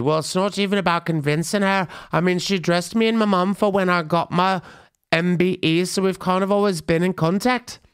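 terrible put-on British accent, high-pitched; badly imitated thick British accent. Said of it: "That's the worst [name] accent".

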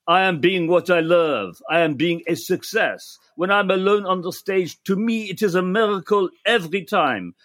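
quoting a French singer in a hint of a French accent; gentle mock French accent. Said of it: "that you did that in French".

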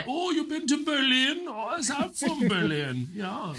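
put-on high, comic German accent. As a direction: chat as a caricature German. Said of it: "it becomes just German".

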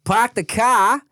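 slow Boston "park the car" with the r dropped; Boston accent. Shown as a demonstration. Friend demos a Boston accent to his co-host, slow and drawn. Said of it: "Boston is".

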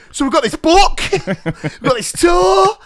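high, loud boasting, the tour stretched out slowly through a laugh; mock showing off. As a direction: play the big celebrity.

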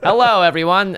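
stiff, high, loud robot greeting. Pretend. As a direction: say it like a little iPad robot. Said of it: "I don't know why I'm talking like a robot".

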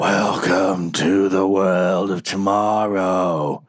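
slow, drawn-out monster voice; muttering creature. Acted. Trident creature to the party, slow creature muttering. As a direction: stretch each word out like an eerie creature. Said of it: "The creature mutters".

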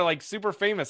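normal talking voice

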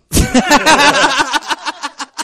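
high delighted laugh; burst of laughter. Real, not acted. Fan recognizes him.

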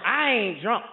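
put-on slow, dragged-out drunk drawl; drunken slur. Slow drunk drawl explaining himself to the cop. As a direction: drag it out like a wasted man.

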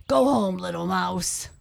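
loud opening, settling down at the end; lion's command. A narrator's reading. Lion sending the little mouse home, commanding. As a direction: order it as the big lion.

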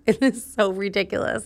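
ordinary delivery

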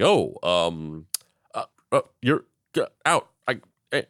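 lost for words, voice jumping up in bits; flustered stammer. Acted. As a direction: splutter broken syllables as if caught out. Retold flustered president, lost for words.

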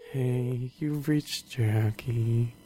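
low, slow, mopey greeting; Eeyore voice. Retold or imitated. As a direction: droop like Eeyore. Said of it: "It's so Eeyore".

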